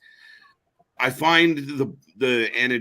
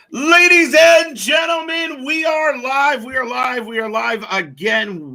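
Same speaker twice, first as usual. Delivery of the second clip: booming announcer intro, high and loud; ringmaster; 13 semitones higher, 8 dB louder, slower than usual. Acted. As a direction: shout it like a ring announcer opening the show. Opening a wrestling live stream.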